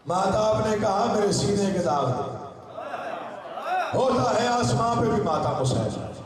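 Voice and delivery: high, sustained recitation pitch; declaimed elegiac verse. Acted. Moon answering Earth with its scarred chest, high declaimed recitation. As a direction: declaim each half-line high to rouse the gathering.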